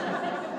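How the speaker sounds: short breathy laugh; quick laugh burst. Real, not acted. Laugh at joke.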